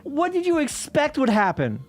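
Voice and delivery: high, slowed, incredulous rant; mock-baffled scolding of a fool; exasperated disbelief. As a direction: ask it like the answer is painfully obvious. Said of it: "And it's like".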